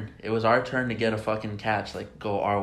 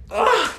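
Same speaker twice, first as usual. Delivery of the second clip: loud, high groan of agony; frustrated groan; 8 dB louder than usual. Real, not acted. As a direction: let out a pained yell. Groans over the game's finish.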